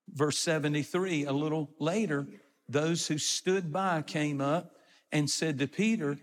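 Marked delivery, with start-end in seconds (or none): none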